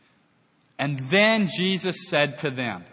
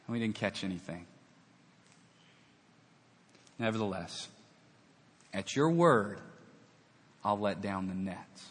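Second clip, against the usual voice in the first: softer, resigned surrender; resigned quiet; 6 semitones lower, 6 dB quieter than usual. Acted. Peter yielding to Jesus's word, softly resigned. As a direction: give in with a tired hush.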